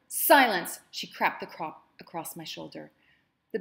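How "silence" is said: loud, high barked silence; angry mistress shouting down a slave; furious command. Acted. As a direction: cut her off like a whipcrack. Madam lashes her with crop.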